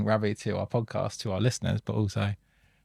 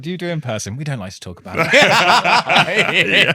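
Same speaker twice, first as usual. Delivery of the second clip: laughing mock evasive; 8 semitones higher, 10 dB louder, slower than usual. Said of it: "people are like".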